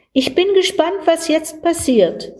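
put-on excited boy. Boy excited watching ants.

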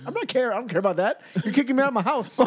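high-pitched imitation of upset girlfriend; hammed-up tearful protest; mock crying. Danielle to boyfriend who is moving in, mock crying. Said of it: "So then Danielle starts crying".

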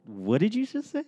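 high, baffled coworker voice; incredulous trainer. Retold or imitated. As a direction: ask it like you can't believe it. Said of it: "the person training me looked at me and was like".

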